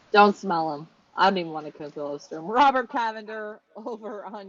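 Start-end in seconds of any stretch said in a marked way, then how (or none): 0.1-2.5 loud, lower joking warning; mock warning to the audience, louder and lower; playful loud tease. Real, not acted. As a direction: call it out teasingly, louder. Teasing joke about smelling him.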